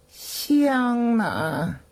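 high, drawn-out call for the servant; old master's demand. Acted. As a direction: demand it imperiously, stretching the words. 主人向仆人喝问香. (老主人拖长声喝问)

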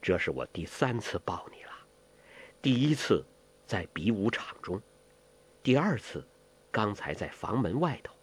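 low, hushed lover's voice; murmured in the ear. A narrator's reading. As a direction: speak softly and low, close to the ear. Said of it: "在他耳边低声地说".

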